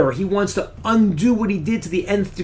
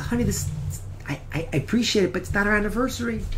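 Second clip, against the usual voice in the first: fast, stumbling, tactful correction; flustered politeness; 5 semitones higher, 5 dB quieter, much faster than usual. Retold or imitated. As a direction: gently set her straight. Husband gently corrects his wife.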